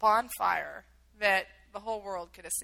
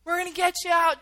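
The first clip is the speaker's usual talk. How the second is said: raised, louder shout over noise; retold screaming; 7 semitones higher, 7 dB louder, faster than usual. Soldiers to captives over loud helicopters, retold shout. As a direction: imitate a rescuer shouting over engines. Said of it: "screaming 'cause it's so loud".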